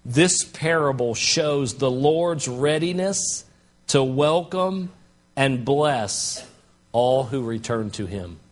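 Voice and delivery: slow, weighty reading of the quote; slow deliberate reading. Shown as a demonstration. Pastor reads a commentator's line slowly to the church. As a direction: lay each word down slowly. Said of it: "commentator said it this way".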